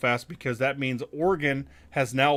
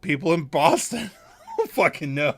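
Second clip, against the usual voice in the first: laughing; 2 semitones higher, 5 dB louder than usual. Real, not acted. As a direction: repeat it through a laugh. Repeats line, laughing.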